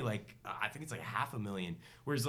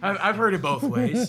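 higher, louder joking quip; playful quip; 7 semitones higher, 10 dB louder than usual. Acted. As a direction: deliver it as a punchline. Jokey quote, laughing along.

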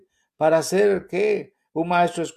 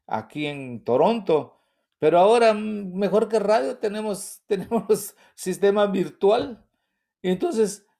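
usual speaking voice

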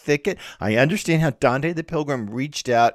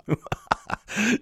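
playful villain chuckle, raised in pitch; mock evil laugh; 7 semitones higher, 5 dB quieter than usual. Lecturer offering listeners a choice, mock-wicked laugh. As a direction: laugh like a pretend schemer.

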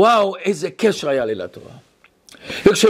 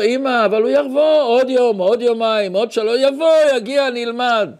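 put-on higher-pitched, hopeful child voice; little boy; 4 semitones higher than usual. Small son reassures his mother the teacher will come, high and insistent. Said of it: "אז אני הייתי קטן".

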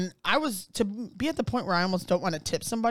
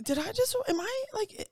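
incredulous. Retold or imitated. Retold disbelief, very high pitch.

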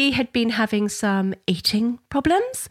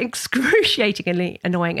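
ordinary talk, nothing marked

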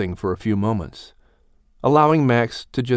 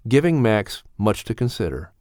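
plain reading voice

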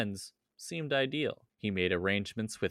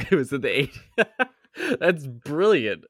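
laughing through the words; cracking up while repeating it; 6 semitones higher, 7 dB louder than usual. Real, not acted. Repeats friend's quip, laughing.

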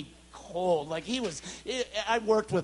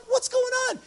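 high, louder, surprised call; startled question; 5 dB louder than usual. Retold or imitated. Preacher asks laughing friend on plane, surprised. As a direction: blurt it out in surprise. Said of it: "And I'm like".

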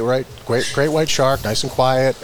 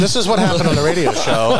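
show announcer read; 5 semitones higher than usual. Shown as a demonstration. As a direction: announce it like a show blurb, higher and brighter. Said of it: "Show description".

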